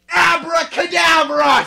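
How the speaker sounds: high, loud, slow shouted spell; yelling. Acted. Vampire yelling a spell at Snape, loud. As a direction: bellow the spell. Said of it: "he yelled".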